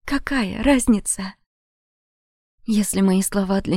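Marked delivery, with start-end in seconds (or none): 0.1-1.4 "Какая разница?" slow, falling, drained; bitter slow repeat. Acted. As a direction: let "Какая разница?" sink hopelessly. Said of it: "горько выдыхая". (горько: «Какая разница?»)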